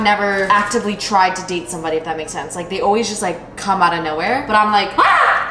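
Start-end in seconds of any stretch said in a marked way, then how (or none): 5.0-5.5 sudden very high playful yelp; mock scared shriek. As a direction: squeal like you're spooked for fun. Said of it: "I'm scared".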